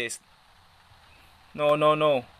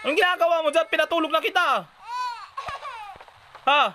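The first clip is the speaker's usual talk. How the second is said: much higher, louder mock scold; exasperated scolding; 3 dB louder, faster than usual. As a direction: scold the baby who got out of bed. Scolding baby out of bed.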